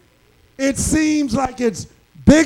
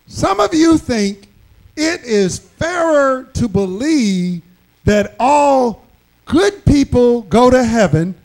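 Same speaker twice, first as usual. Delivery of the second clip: ordinary delivery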